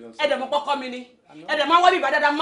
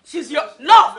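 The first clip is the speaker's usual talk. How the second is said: louder, disbelieving throw-back of his words; outraged echo; 9 dB louder, much slower than usual. Acted. Wife hurls husband's confession back, outraged echo.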